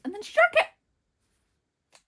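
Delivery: high, loud, drawn-out squawk of disbelief; exasperated outburst. Real, not acted. Reader aghast that Pip drank the tea, exasperated outburst. Outraged retelling of plot.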